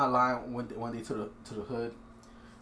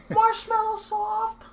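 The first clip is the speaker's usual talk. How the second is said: mocking whiny kid voice; 4 dB louder, much slower than usual. Mocking soft kids.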